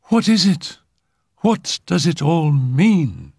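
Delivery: high, anxious wail of a question; distressed cry. A narrator's reading. Phyllis begging the doctor to explain, high distressed cry. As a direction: plead high, stretching out the last question. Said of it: "she cried".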